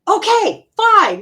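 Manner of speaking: loud, high-pitched exasperated surrender; exasperated giving in. Retold or imitated. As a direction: give in loudly, fed up with being nagged. Gives in to the yelling voice.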